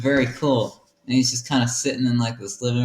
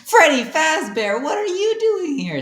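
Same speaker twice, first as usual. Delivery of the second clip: much higher, louder startled cry; surprised high squeal; 6 dB louder than usual. Acted. Josh greets Freddy Fazbear in a high startled squeal. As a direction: squeal it, stunned to see an old friend.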